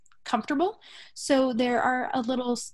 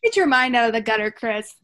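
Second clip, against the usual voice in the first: teasing; 6 dB louder than usual. Real, not acted. Teasing after the pun.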